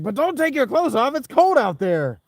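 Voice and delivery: very high, loud squeal; loud high panicky voice; the body's voice. Pretend. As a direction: shriek a panicked warning. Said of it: "the body's like".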